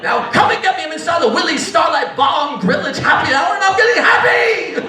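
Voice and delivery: high, hammed-up mom shout; hollering mother. Mother at happy hour calls son, mock hollering.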